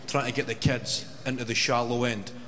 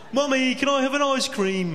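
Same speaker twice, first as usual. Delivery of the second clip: put-on high child's plea, louder, laughing through it; whiny kid asking; 14 semitones higher, 4 dB louder than usual. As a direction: beg mum for an ice cream like a little kid. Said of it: "It's that accent again".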